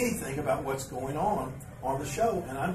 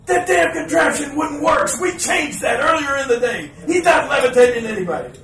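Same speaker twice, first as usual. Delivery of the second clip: exasperated outburst; 13 semitones higher, 14 dB louder than usual. Retold or imitated. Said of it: "he goes, uh, he said".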